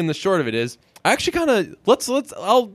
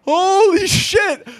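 slow, much higher stunned cry; mock shock; 5 dB louder, much slower than usual. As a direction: gasp it like a scandal. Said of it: "every time it's like".

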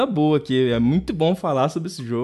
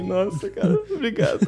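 grateful gush; 7 semitones higher than usual. Retold or imitated. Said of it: "Eu fiquei".

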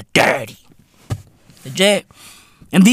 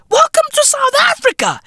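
much higher, hammed-up shouted welcome; mock welcome shout. Greeting Xi Jinping on arrival, with a high mock shout. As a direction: bellow the welcome like a street hawker.

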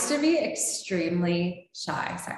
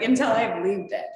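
normal talking voice

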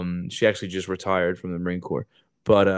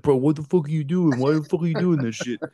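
mock annoyed, higher; mildly irritated outburst; annoyed classmates; 6 semitones higher than usual. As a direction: grumble at him in disbelief. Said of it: "everyone's like".

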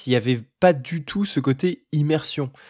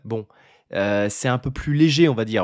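usual speaking voice

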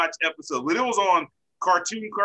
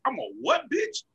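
high-pitched mock outrage; cartoon outrage leaping up into a squeak; indignant cartoon quote; 7 semitones higher, 4 dB quieter than usual. Acting out a quoted cartoon line.

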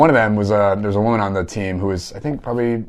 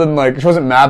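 normal talking voice

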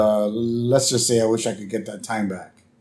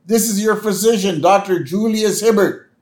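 high, sustained calling voice; Doctor Hibbert; 8 semitones higher than usual. Retold or imitated. Host as doctor calling to lost Homer, calling out. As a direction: project it as if to someone far away.

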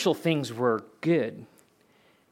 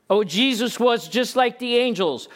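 higher-pitched voicing of a doubter; dismissive mock quote; 7 semitones higher, 3 dB louder than usual. Preacher quoting a false claim about Jesus, dismissively. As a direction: toss out the claim in a raised, mimicking pitch.

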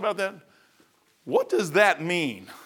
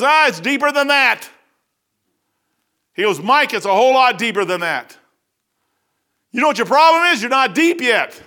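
high, louder preacher's voice for the Lord; voicing the Lord; 7 semitones higher, 5 dB louder than usual. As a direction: speak as the Lord correcting him, emphatic. Lord's reply voiced in story.

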